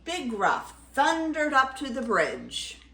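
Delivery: ordinary delivery, nothing marked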